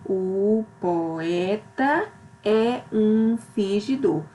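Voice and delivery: "O poeta é um fingidor" read slowly, each syllable set apart; slow demonstration reading. Shown as a demonstration. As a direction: spell the verse out slowly, syllable by syllable. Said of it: "quando eu separo cada sílaba".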